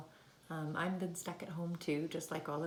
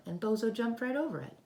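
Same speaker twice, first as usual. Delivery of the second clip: higher and louder, mounting wonder; building excitement; 8 semitones higher, 6 dB louder than usual. A narrator's reading. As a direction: build up each jump eagerly. Building excitement as frog jumps.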